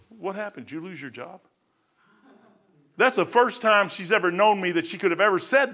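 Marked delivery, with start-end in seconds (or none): 0.1-1.4 much softer, hushed wife's question. Retold or imitated. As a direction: ask gently, almost under breath. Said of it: "she goes".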